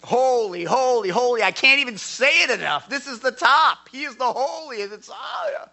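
very high, loud exclamation; high, loud awestruck cry; ecstatic praise. Retold or imitated. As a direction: burst out in overwhelmed awe. Acting out infinite holiness.

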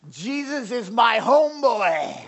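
put-on slow, high drunken bellow; hammered guy. Drunk stranger boasting to tract giver, slow holler. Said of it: "this guy just completely hammers".

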